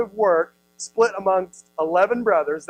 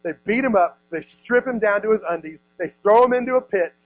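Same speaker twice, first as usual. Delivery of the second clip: ordinary talk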